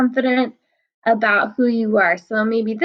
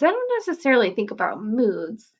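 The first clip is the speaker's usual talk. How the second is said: ordinary delivery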